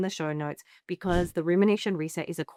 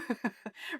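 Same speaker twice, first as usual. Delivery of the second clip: short laugh; 4 semitones higher, 9 dB quieter than usual. Real, not acted. Laughing after demo.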